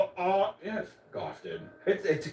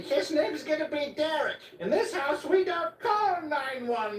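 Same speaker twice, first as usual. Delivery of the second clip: put-on much higher, laughing old woman voice, slowing at the end; mock old lady; 12 semitones higher, slower than usual. Imitating Gladys announcing her baby, high laughing mock voice. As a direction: ham up the devout old lady. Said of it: "she's just like".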